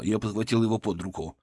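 ordinary delivery

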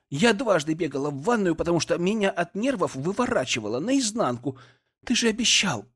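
anxious wife near tears; upset wife. Acted. Pregnant wife scolds late husband.

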